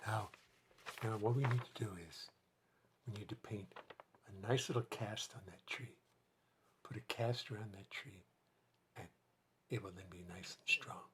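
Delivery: hushed, gentle painting-instructor voice; Bob Ross. Acted. Guiding viewers to paint a cast on a tree, hushed. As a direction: speak very softly and low, nearly whispering. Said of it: "whether you heard Bob Ross talking".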